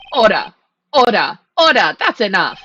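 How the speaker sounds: loud stern repeated commands; judge calling order. Acted. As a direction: bang the gavel with your voice.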